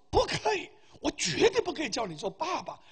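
high mock-angry protest; angry refusal. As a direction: imitate a man refusing indignantly. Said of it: "他很生气".

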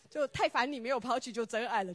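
usual speaking voice

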